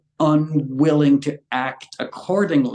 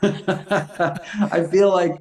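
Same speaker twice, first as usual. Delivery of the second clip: laughing; 4 semitones higher, much slower than usual. Real, not acted. Laughing at self-mockery.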